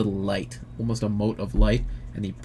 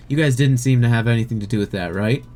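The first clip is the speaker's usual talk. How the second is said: quick, probing question; fast suspicious question; faster than usual. Acted. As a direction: rush the accusation out. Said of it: "he says".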